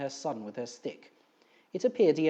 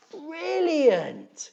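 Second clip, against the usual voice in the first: very high, loud cheer; shouted; 14 semitones higher, 8 dB louder than usual. Acted. Finton cheering to Gribley over the noise, a high loud shout. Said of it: "he shouted over the noise".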